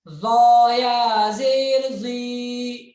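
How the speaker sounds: ज़ो said thick with the tongue at the teeth, loud; tongue on the teeth, heavy voice for ज़ो; heavy zoe. Shown as a demonstration. Teacher showing students the heavy zoe with a thick voice. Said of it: "आवाज मोटी करेंगे".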